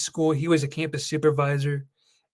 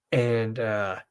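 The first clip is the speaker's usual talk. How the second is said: normal talking voice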